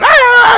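sudden high loud yelp; a jolting squeal; high startled ah. Retold or imitated. Pastor acting a wife startled, high yelp. Mock startled cry before phone.

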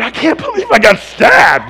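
loud, slow shout of disbelief; retelling his shocked thought loudly. Retold or imitated. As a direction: yell it in shock. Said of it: "my mind's going".

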